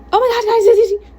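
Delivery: high, loud excited gushing; frantic excitement. Acted. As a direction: squeal the news to friends in a rush. Hassan runs up thrilled about fight.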